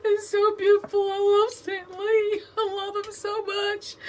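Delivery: high crying voice, laughing through the tears; tearful gush. Retold or imitated. Retelling crying at the Stan Lee tribute, high and tearful. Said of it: "I'm like".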